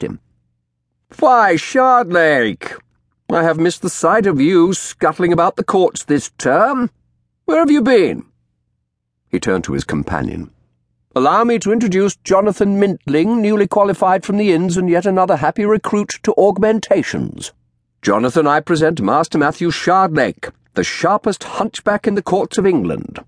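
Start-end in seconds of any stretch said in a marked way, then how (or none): 1.2-8.2 high, loud, drawn-out hail; hearty lawyer greeting. A narrator's reading. Lawyer hailing a colleague in the street, loud and hearty. Pepper hails the narrator, mocking. 11.1-23.2 high, mocking flourish; showy lawyer introduction. A narrator's reading. Lawyer introducing his companion to Shardlake, high and showy.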